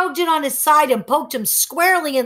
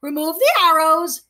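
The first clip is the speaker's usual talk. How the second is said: encouraging parrot; 6 semitones higher, 3 dB louder than usual. Acted. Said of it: "Che encouraged".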